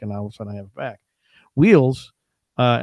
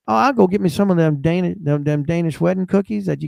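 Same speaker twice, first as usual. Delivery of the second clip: put-on Southern accent; 3 semitones higher, 4 dB louder than usual. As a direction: lay on a folksy Southern accent. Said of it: "all of a sudden she's Flo from Mel's Diner".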